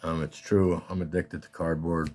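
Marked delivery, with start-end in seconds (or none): none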